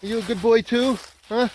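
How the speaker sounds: talking to a dog. Real, not acted. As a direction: coo at a friendly pup.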